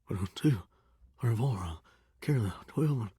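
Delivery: low, soft murmured arithmetic; counting to himself. Acted. Shower tallies minutes in a day, murmuring to himself. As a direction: work the sum out under your breath.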